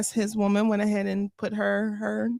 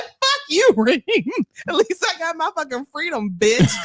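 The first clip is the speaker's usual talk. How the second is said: mock shout; 7 semitones higher than usual. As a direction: yell it back, half laughing. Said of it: "I'd be like".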